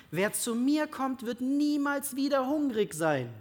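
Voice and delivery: high, slow, solemn Bible reading; scripture reading. Acted. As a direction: proclaim the words of Jesus slowly.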